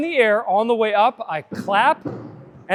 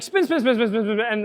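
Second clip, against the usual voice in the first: rapid run-on repetition, laughing slightly; no cadence. Shown as a demonstration. Coach mimicking people who rush the claps, hurried laughing run-on. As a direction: rattle the words off without any beat. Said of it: "there's no cadence".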